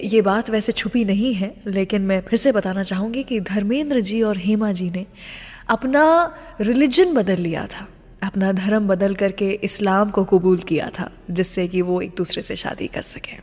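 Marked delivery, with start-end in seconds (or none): none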